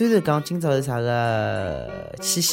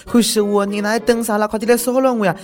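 high, teasing come-on; coy flirty plea; 8 semitones higher than usual. Pretend. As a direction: tease them to come bother you. Host plays at inviting harassment.

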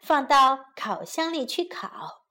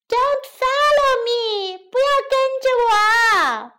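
high creature voice calling back; gingerbread man shouting; 7 semitones higher, 3 dB louder than usual. A narrator's reading. Gingerbread man flees the cow, high shout. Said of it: "生姜面包人边跑边喊".

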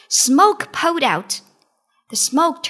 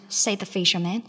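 ordinary delivery, nothing marked

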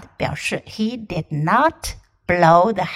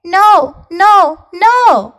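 high, loud little pigs; 8 semitones higher, 9 dB louder, much slower than usual. Acted. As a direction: squeal the refusal like pigs. Three pigs refusing.

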